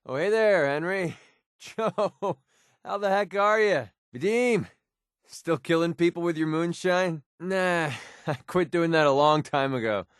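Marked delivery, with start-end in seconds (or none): 0.0-7.2 high-pitched, chuckling hello; pleased-to-see-you greeting; jovial greeting. Acted. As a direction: hail old friends with lifted pitch. Hails old friends happily.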